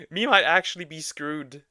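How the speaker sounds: laughing through the words. Real, not acted. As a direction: talk while still laughing. Laugh right after the outburst.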